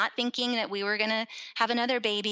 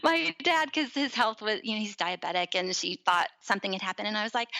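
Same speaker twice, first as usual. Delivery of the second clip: ordinary delivery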